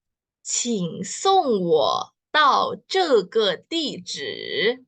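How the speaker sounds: "请送我到这个地址" said very slowly, word by word; slow Mandarin. Shown as a demonstration. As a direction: teach the full sentence slowly. (pelafalan Mandarin sangat pelan)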